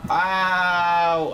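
high, loud ow; pained yelp. Real, not acted. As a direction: yelp in surprise. Stabbed in game.

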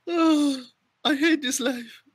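high-pitched, hammed-up whine; mock despair. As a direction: lament it in a squeaky joking wail. Mock despair amid laughing fit.

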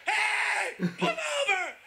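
high, frantic acted shout, last words drawn out; panicked yell. Passenger screams at the cab driver to stop, panicked yell.

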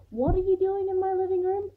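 high, loud, incredulous demand; indignant question. A narrator's reading. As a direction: ask it like an affronted challenge. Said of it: "more like the question".

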